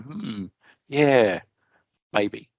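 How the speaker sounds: musing, with pauses between words; pondering listener. Retold or imitated. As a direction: mull it over like a half-convinced listener. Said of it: "you're going".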